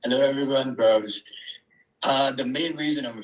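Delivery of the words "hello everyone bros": normal talking voice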